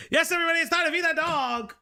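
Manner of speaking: high, fast hype announcement; excited announcement. Acted. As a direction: call it out to the whole chat. Announcing to chat.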